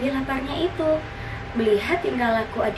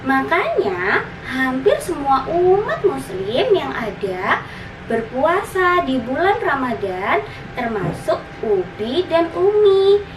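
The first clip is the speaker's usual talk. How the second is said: high, lilting big-sister voice; explaining big sister; 3 semitones higher than usual. Acted. Older sister explains Ramadan fasting to her little brother, high explaining voice. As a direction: explain it sweetly, stretching the key words. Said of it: "jelas sang kakak".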